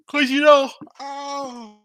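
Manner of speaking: words stretched through a big yawn. Real, not acted. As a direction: talk while a yawn takes over. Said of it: "excuse me for my yawn".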